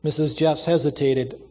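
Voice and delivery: ordinary delivery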